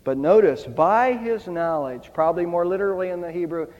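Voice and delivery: ordinary talk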